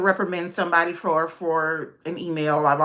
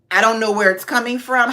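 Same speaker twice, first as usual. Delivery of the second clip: ordinary delivery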